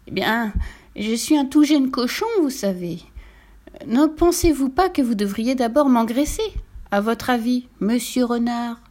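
lightly voiced polite little pig; wheedling piglet. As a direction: coax the fox politely. (petit cochon poliment enjôleur)

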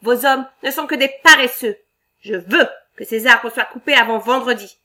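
loud, demanding boss barking orders; bossy shout. Acted. Boss threatening the workers.

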